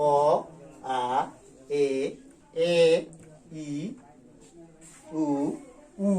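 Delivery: each vowel said slowly and separately; teacher summing up the basic vowels; vowel recital. Shown as a demonstration. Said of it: "মৌলিক স্বরধ্বনি".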